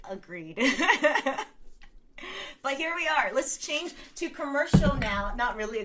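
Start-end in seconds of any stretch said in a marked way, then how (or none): 0.6-2.6 louder, high laugh; delighted laugh. Real, not acted. Agreeing with viewer about Fox News, delighted laugh.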